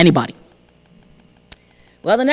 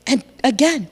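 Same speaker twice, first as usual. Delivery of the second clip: ordinary delivery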